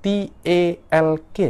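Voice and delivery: "T E L K" spelled letter by letter, slowly; "T E L K" spelled out slowly, one letter at a time; spelling out letters. Shown as a demonstration. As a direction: read each letter of "T E L K" out slowly. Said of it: "Kan ditulisnya kan".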